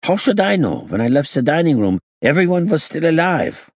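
put-on high, agitated accented voice; defensive gangster. A narrator's reading. Gangster defending himself to the inspector, high agitated voice. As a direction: protest innocence in a high foreign accent. Hincus protests innocence.

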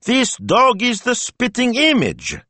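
very high, slow astonished grandmother; high, emphatic amazement; delighted exclaiming. A narrator's reading. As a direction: marvel at the likeness, stretching words. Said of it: "Betta burst out laughing".

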